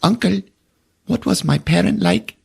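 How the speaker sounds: put-on the boy asking his uncle; curious kid. Boy asks about parent.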